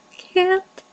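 high, thin squeak. Acted. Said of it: "she squeaked".

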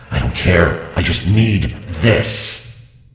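loud booming demon voice. Acted. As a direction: roar it out like a demon. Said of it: "You wanna hear my demon voice?".

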